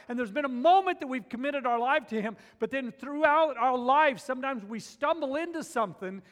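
loud, high preaching build; impassioned sermon at full volume; fiery preaching. Acted.

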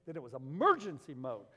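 ordinary talk

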